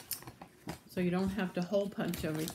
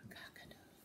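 barely audible crow; whispered prompt; 23 dB quieter, faster than usual. Acted. Teacher letting the children say it, prompting in a whisper. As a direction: mouth it almost silently.

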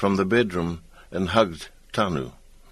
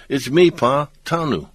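raised, higher call; 6 semitones higher, 3 dB louder than usual. A narrator's reading. Boy calling out to his grandfather in the dark, raised and higher. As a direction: call out so he knows who you are. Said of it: "Tanu called out".

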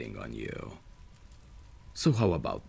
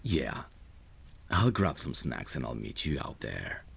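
usual speaking voice

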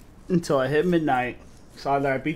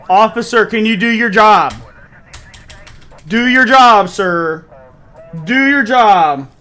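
loud, high, insistent shouting; yelling demand; 4 semitones higher, 10 dB louder than usual. Real, not acted. Streamer yelling at the useless game officer, loud and insistent. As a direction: demand it louder each time.

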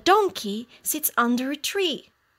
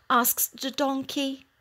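ordinary delivery

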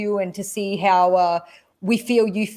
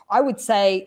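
ordinary delivery

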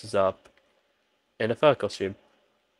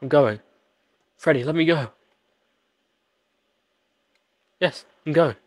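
higher, louder mock begging; hammed-up pleading; 4 semitones higher, 6 dB louder than usual. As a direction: whine it up at Freddy.

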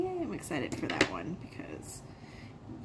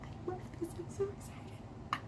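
excited whisper; 10 semitones higher, 10 dB quieter than usual. Real, not acted. Whispered thrill over hat.

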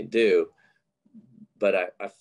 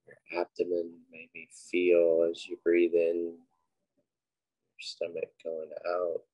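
unhurried, quiet cues for breath and body; guided meditation; 3 dB quieter than usual. Acted. Leading listeners through breathing and a body scan, guided meditation.

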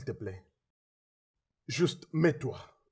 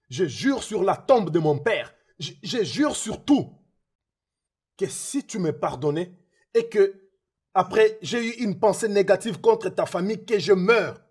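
desperate loud oath; 5 semitones higher, 6 dB louder than usual. Acted. Man swears on his father's grave to be forgiven, desperate loud vow. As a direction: vow it as if your life depends on it.

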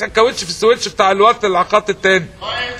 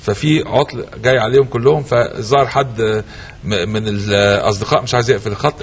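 low formal broadcast voice; announcer voice; 9 semitones lower than usual. Acted. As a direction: announce it like a TV host. Host announcer read.

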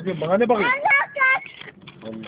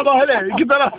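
higher, louder excited talk; heyecanlı; 7 semitones higher, 5 dB louder, faster than usual. Real, not acted. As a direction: rush in, excited. Excited about the bleeding. (heyecanla yüksek sesle)

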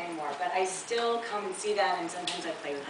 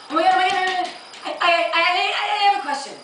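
high, loud, breathless rush of words; frantic; 11 semitones higher, 9 dB louder, faster than usual. Acted. Day tripper bursting into bookstore with a question, frantic. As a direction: panic loudly, tripping over repeated words. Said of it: "like, frantic".

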